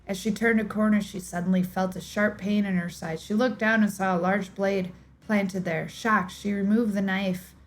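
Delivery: steady, measured storyteller read; audiobook-style narration of a mystery; story narration. A narrator's reading. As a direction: draw listeners into a dark tale. Reads a dark story aloud.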